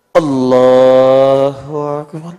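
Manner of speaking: slow, loud drawn-out Allahu Akbar; solemn stretched takbir; prayer takbir. Acted. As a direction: call the takbir long and full like starting salat. Acting the opening takbir of prayer.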